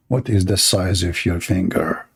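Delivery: low, slightly softened mocking replay; put-on low voice, mocking the commenter; mock creepy question. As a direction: repeat the odd question low, deadpan.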